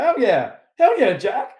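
loud, high pumped-up agreement; hyped-up yes. Retold or imitated. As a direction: jump at the offer, fired up. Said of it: "And he's like".

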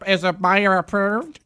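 high, slow lightly voiced agent; a hint of an official email voice; agent's voice. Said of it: "he's putting some voices on".

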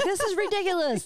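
high, hammed-up grumpiness; mock crabby. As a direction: voice a friend's cranky protest in a high voice. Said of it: "You're crabby".

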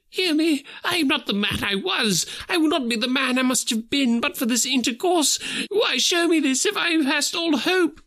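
clutch the robe and plead for mercy; Scrooge, desperate. Acted. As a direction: Scrooge begging the spirit for hope.

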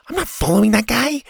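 high-pitched protest; incredulous refusal. A narrator's reading. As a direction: balk at the idea, pitch shooting up. Child balks at following the man.

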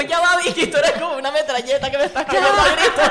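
high, loud mock outburst, laughing through it; retold yelling. Friend retelling Andrea yelling at Vicky mid-fight, mock shouting. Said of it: "todos nos empezamos a gritar y Andrea dijo".

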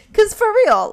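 ordinary talk, nothing marked

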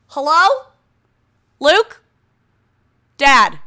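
loud calls across the house; calling out. Real, not acted. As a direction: shout for someone in another room. Calling out into the basement.

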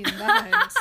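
loud, high dramatic title; dramatic announcer. Real, not acted. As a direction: announce it like a trailer.

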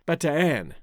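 Bataan in three syllables, "bah-tah-AN"; Philippine pronunciation. Shown as a demonstration. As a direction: sound out both a's in Bataan, Philippine style. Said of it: "a more Philippine pronunciation".

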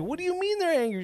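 quick, high-pitched retold disbelief; incredulous questioning. Driver challenging his passenger's claim, high-pitched disbelief. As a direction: fire back the question, pitch way up.

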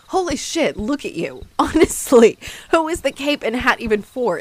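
laughing mockery. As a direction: laugh at his silly outfit while asking. Mocking his outfit, laughing.

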